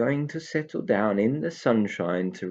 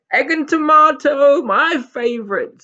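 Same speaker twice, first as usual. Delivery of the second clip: much higher, louder happy squeal; joyful; 12 dB louder than usual. Acted. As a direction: swoon over the favourite. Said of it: "said Tigger joyfully".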